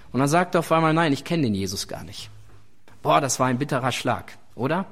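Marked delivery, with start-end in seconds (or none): 0.9-2.3 low-pitched, understated disowning; quick brush-off in a lower register; dismissive denial. Acted. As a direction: deny it under your voice, lightly acted. Acts out Peter's denial.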